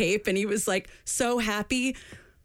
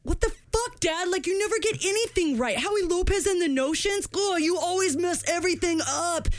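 put-on much higher whiny kid tantrum; temper tantrum; 10 semitones higher than usual. Son raging at his dad over the wrong tape, high bratty tantrum. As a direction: throw a bratty high-pitched fit. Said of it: "he threw a huge temper tantrum".

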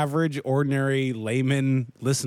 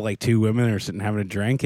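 normal talking voice

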